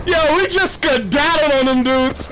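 laughing through the words. Real, not acted. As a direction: giggle while telling it. Laughing after escaping enemies.

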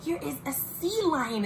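ordinary delivery

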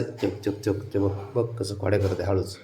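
shh, don't disturb her, look; hushed. Real, not acted.

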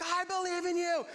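high-pitched mock fan cry. Man cheering on the walker, crying out. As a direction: yell it like an all-in believer.

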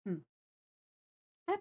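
normal talking voice

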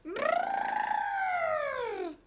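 high, long trumpeting; playful high buzzing trunk call; elephant trumpet. Shown as a demonstration. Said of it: "He makes a sound with his big".

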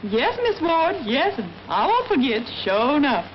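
drawn-out Southern accent, "won't" stretched; slow Southern drawl, "Miss" lifted. Shown as a demonstration. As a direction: demonstrate the maid's Southern drawl. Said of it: "Now, listen".

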